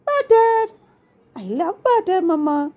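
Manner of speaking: put-on high-pitched child voice; little kid telling mom what she loves; little girl Rini. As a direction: start high like a small daughter, easing down.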